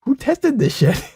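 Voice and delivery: high, slow, outraged squeak; incredulous. Retold or imitated. Mocking the two-hour taco challenge in a high, slow outraged squeak. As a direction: jump way up in pitch and slow down, appalled. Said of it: "I'm like".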